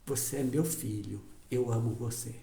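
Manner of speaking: soft, low, loving voice; tender father. Acted. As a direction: say it gently, like a father holding his son. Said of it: "O pai diz". (pai terno, voz baixa e suave)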